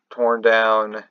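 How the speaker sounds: usual speaking voice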